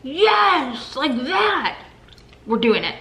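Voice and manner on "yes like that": high, bright burst of excitement; suddenly thrilled, pitch jumping up; excited. Real, not acted. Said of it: "I don't think you've ever been that excited".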